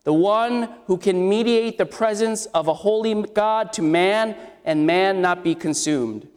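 ordinary delivery

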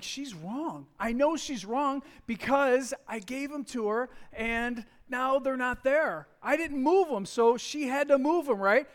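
a mock rant, growing heated; getting steamed. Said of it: "I'm getting a little steam now".

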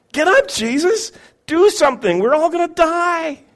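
high, strained frantic yell; panicked shout. Acted. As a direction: lose it as the boat sinks. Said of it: "They're pretty much losing it".

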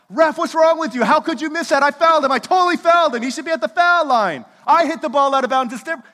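loud, high, hammed-up protest at the referee; over-the-top indignant shout pretending to be fair; mock outrage at the ref. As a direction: yell at the ref as if the wrong call went your way. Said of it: "when's the last time you got that upset at a referee".

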